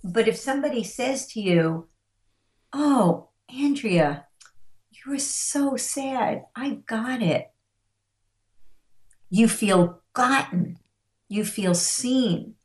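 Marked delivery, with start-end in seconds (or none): 2.7-7.5 slow, higher-pitched sympathy; empathetic validation. Shown as a demonstration. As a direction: show someone you feel for them, unhurried. Models validating someone's sadness.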